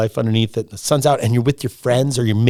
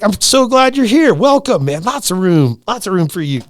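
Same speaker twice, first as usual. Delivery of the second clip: high, effusive welcome; enthusiastic welcome; 8 semitones higher, 4 dB louder than usual. Retold or imitated. People greet a newcomer to the group, enthusiastically welcoming. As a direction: welcome them in with open arms.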